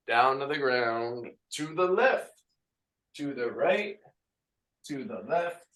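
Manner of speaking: upbeat class count, sung out; fitness instructor calls. Acted. Instructor counting reps for the class. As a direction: lead the class through reps. Leading cooldown and stretch.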